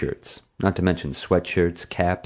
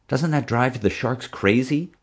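lightly voiced friend, pitched higher; eager young friend; 7 semitones higher than usual. A narrator's reading. Friend wondering about the shark tunnel, eager. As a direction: ask in a higher, curious voice.

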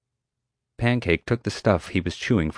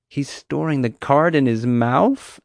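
surprised; 6 semitones higher, slower than usual. Acted. Kid watching the orangutan hide the card, surprised. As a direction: stretch it out in amazement.

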